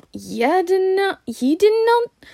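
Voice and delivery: loud, very high incredulous cry; stunned disbelief. A narrator's reading. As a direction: shriek it in utter disbelief. Hagrid is stunned by Harry's ignorance.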